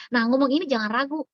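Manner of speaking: ordinary talk, nothing marked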